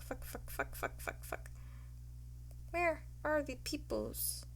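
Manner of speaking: ordinary talk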